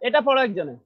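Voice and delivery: ordinary talk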